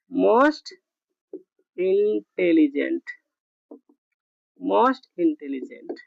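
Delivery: drawn-out teaching delivery; teacher dictating slowly; slow dictation. Shown as a demonstration. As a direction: say most intelligent slowly and low.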